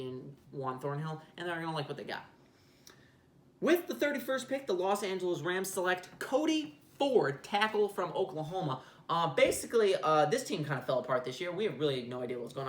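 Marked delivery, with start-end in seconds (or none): 3.6-8.8 higher, slow announcer read of the pick; mock commissioner announcing the selection; draft announcer.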